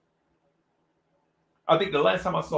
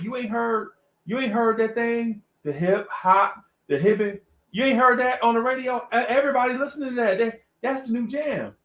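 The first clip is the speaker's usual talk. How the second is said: higher-pitched eager retelling; excited cousin; 6 semitones higher than usual. Retold or imitated. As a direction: imitate a relative hyping the new sound. Cousin excitedly backs the kid.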